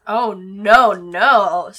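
real loud cry of dismay; dismayed outburst at a bad roll. Real, not acted. Player reacts to a bad roll.

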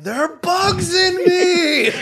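high, slow, laughing shriek; freaked-out shout. Retold or imitated. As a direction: wail it out in a panicked laugh. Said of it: "and you're like".

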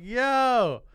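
high, very loud drawn-out cry; delighted shout. Retold or imitated. Shopper realising the guard is a fellow fan, delighted shout. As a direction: explode with thrilled recognition.

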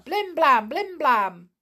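loud, punchy knock sounds. Acted. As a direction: bang it out like a door knocker.

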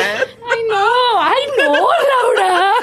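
high, loud, slow laughing wail; a pearl-clutching mock outrage through laughter; scandalized laughing. Feigning shock that friends ate gummies, high laughing wail.

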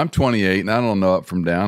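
ordinary delivery

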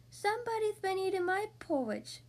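high little bear-cub voice; small, high, childish cub; Baby Bear squeak. A narrator's reading. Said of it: "squeaked, squeaked Baby Bear".

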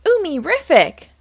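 high, loud little cheer. A narrator's reading. Millie admires the new snowmobile, cheering. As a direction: cheer it out with delight. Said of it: "Millie cheered".

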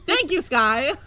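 loud, high happy shout; excited cheer. Real, not acted. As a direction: cheer her on gleefully. Cheering Skye's punch.

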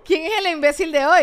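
loud, high announcer-style hype; mock contest hype. Hosts asking viewers who is dumbest, loud mock hype. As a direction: call it out to the audience as a contest.

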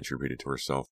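ordinary delivery

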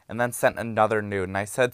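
ordinary delivery

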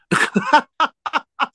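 bursting into a high, loud laugh. Real, not acted. Drag host laughing at the memory of being charged: high, loud laugh. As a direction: let out a high-pitched cackle. Recalling drunk man charging her.

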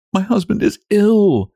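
very high, held-back distress; tearful wife keeping control; restraining sobs. A narrator's reading. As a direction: hold the tears in, voice pitched right up. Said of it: "restraining her sobs".

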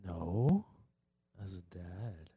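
low, quiet, ominous villain; mock-menacing dad as evil villain. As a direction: drop it deep and soft like a movie villain. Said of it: "There goes that evil villain voice".

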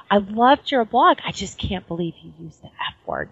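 high quoted friend voice; a lightly voiced friend; friend quote. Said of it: "she's like".

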